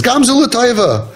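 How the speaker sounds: high, drawn-out mock consolation; mock catchphrase. Teacher mimics people consoling someone in pain, mock catchphrase. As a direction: parrot a pat saying, pitched well up and slowed. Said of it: "all of these catchphrases".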